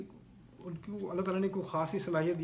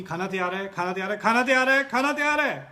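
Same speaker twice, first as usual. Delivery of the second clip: starts loud, climbs higher and louder with each repeat; calling out, building; 4 semitones higher, 10 dB louder than usual. Retold or imitated. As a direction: call the family to dinner, louder every time. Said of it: "میں اگر بلاؤں گی". (بار بار بڑھتی ہوئی اونچی آواز میں پکارنا)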